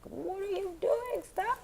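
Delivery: flustered protest. Retold or imitated. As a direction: tease them to stop, embarrassed. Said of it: "I'm just like".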